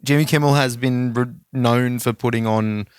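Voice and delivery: normal talking voice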